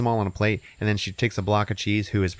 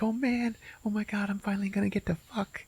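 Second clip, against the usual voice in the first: high, soft excited cartoon voice; cartoon hot dog, breathless and eager; squeaky hot dog voice; 13 semitones higher, 6 dB quieter than usual. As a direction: squeak it like a nervous little sausage. Hot dog voiced in retold movie.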